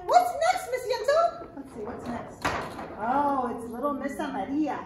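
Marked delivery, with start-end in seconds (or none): 0.1-1.5 high, loud excited puppet voice, partly sung. Acted. Puppet Arturo greeting the children, high excited voice. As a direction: bounce in as an eager little monkey puppet.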